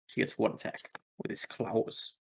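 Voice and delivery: ordinary talk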